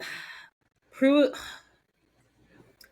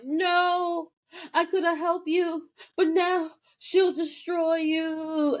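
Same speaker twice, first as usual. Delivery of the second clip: mock dramatic demon; 10 semitones higher, slower than usual. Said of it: "and is like".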